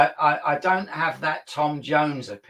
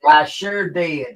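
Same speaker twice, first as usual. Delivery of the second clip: slow, louder drawl in a put-on American accent; mock American accent; 5 dB louder, slower than usual. Country singer in a hat answers the host, in slow, louder American drawl. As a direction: lay on a cowboy American accent. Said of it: "like, broad American accent".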